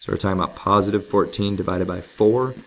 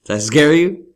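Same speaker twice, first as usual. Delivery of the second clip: teasing; 7 semitones higher, 7 dB louder than usual. Pretend. Teasing after jump-scare.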